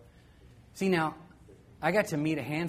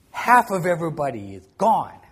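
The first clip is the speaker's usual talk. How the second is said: loud, grand declaration; a boy's loud, awed proclamation; dramatic kid announcement; 11 dB louder than usual. Retold or imitated. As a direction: announce it like doom. [name] explains the snap.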